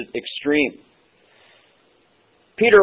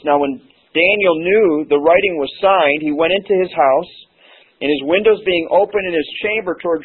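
raised, measured Bible reading; scripture reading; 4 semitones higher than usual. Acted. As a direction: read the verse out to the congregation. Reads scripture passage aloud.

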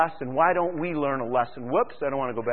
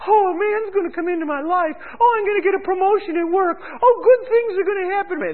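much higher, breathless gushing falsetto; mock giddy caller; 5 dB louder than usual. Preacher mocks psychic-line callers in a much higher gushing falsetto. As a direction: gush like a gullible fan of the ads.

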